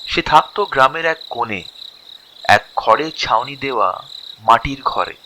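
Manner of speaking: usual speaking voice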